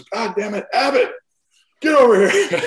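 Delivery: high, loud mock yell from the stairs; retold scream. Coach spotting the player on the stairs, loud mock yell. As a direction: bark his name across the room in a mock rage. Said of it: "he just started screaming at me".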